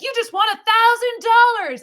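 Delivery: high, loud prize-winner hype; hyped DJ announcement. Retold or imitated. DJ telling a caller they won, high loud and hyped. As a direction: sell it like a radio giveaway.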